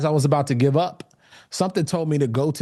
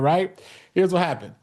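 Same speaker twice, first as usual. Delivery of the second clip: usual speaking voice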